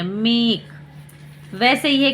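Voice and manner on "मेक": मेक with a drawn-out "ay"; long A sound. Shown as a demonstration. Teacher reading the example, मेक with long "ay". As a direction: hold the "ay" in मेक, then close on k. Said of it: "इसे ए ही पढ़ेंगे".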